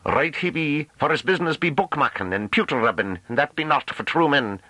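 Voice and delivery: very high country voice; jeering rustic farmhand. A narrator's reading. Mate behind mocking the scholar's bookish business, jeering. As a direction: chime in high and scornful. Another farmer jeers from behind.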